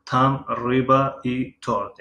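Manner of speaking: slow Russian, stress on Там; slow teacher demo; slow model reading. Shown as a demonstration. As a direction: read slowly, leaning on Там. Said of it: "ماهی و تارت است".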